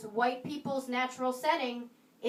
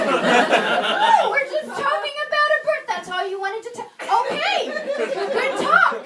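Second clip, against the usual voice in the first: higher, louder, laughing through it; mock relief; 10 semitones higher, 8 dB louder than usual. Comic tells the stranger good talk, high and laughing. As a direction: fake chipper relief. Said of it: "I was like".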